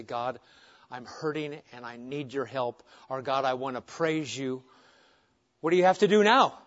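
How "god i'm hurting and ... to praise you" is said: a hushed, hurting plea to God; soft pleading prayer. Acted. A believer praying softly to God, hurting and pleading. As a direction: pray it quietly, as if grieving.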